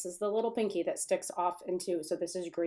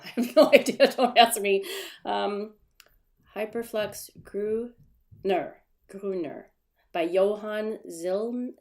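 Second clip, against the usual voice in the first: ordinary talk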